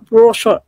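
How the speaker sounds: "process" blurred into something like "prosho"; imitated unclear pronunciation. Recalling the word that wasn't understood, imitated pronunciation. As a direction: garble the end of "process", imitating what was heard. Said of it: "Sabi, ah".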